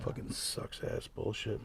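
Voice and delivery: muttered under the breath, slow; whispered curse. Real, not acted. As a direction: grumble it almost silently.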